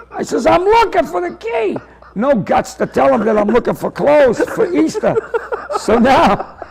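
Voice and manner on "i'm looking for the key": high, singled-out excuse called back; calling back. Retold or imitated. Teller covers up to the man in the box, high-pitched call.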